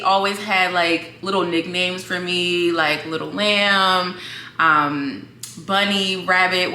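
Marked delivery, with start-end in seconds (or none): none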